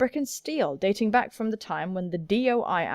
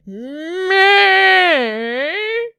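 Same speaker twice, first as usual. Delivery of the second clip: awkward noise; 8 semitones higher, 10 dB louder than usual. Acted. Imitates the awkward embarrassed expression.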